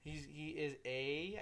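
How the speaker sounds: ordinary talk, nothing marked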